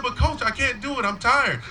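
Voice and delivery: high, whiny tearful-child impression; mock wail of an exhausted kid; imitated crying kid. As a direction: plead with the coach in a kid's high voice. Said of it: "and he's crying".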